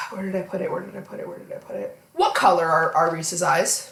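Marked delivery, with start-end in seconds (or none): 0.1-2.0 quick hushed mutter, fading to a near whisper; muttering to self. Real, not acted. Host hunting for her notes, muttering to herself. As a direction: talk under your breath while searching, quicker and softer.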